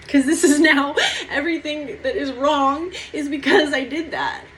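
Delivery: fighting back real tears, laughing through them; on the verge of tears. Real, not acted. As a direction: voice breaking with a nervous laugh. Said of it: "It's gonna make me cry".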